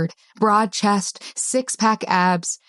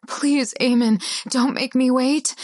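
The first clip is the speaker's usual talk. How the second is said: higher, pleading whimper; whimpered plea; 6 semitones higher than usual. A narrator's reading. Heroine begging Eamon not to wait, whimpered plea. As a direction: beg him in a high little whimper. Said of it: "I whimpered".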